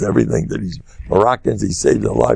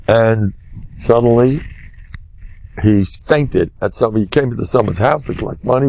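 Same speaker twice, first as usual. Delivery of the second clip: normal talking voice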